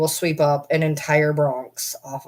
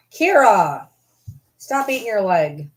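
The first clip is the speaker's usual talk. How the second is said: sharp call; 4 semitones higher, 4 dB louder than usual. Real, not acted. Scolding dog.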